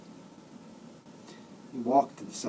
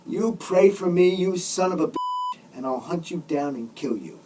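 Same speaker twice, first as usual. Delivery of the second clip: higher, indignant warning; angry threat; 6 semitones higher than usual. A narrator's reading. Patient threatens the driver who offers to pray, angry. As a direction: warn him off angrily.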